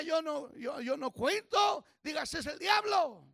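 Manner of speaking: loud, rising sermon voice; fiery preaching. Acted. Pastor urging the congregation, fervent preaching. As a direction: drive the point home to the church.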